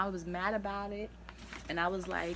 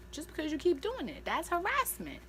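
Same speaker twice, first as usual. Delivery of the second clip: higher, indignant outburst; outraged; 9 semitones higher than usual. Real, not acted. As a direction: call out the harasser, heated. Ranting at attacker.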